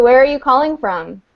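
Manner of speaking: ordinary delivery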